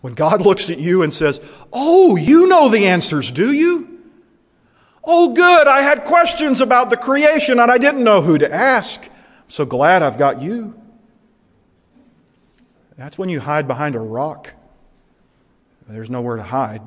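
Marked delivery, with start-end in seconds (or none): 1.7-10.7 very high, loud mock delight; hammed-up sarcastic amazement, voice shooting up; sarcastic God. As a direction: gush with exaggerated fake relief. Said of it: "God being sarcastic".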